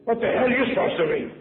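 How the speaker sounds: high, loud incredulous demand; retold rough challenge. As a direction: imitate a sailor loudly challenging the new boy. Retold crew challenging the newcomer.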